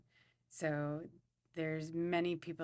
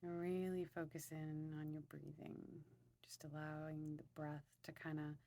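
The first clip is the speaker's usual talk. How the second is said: guided meditation; 4 dB quieter than usual. Acted. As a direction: ease them softly into their breathing.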